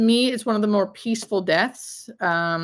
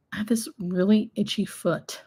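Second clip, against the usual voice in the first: whispering; 4 dB quieter than usual. Real, not acted. Whispered aside on stream.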